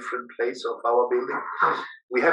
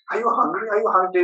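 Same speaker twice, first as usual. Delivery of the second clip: incredulous question; 8 semitones higher, faster than usual. Retold or imitated. Offered food by visitors.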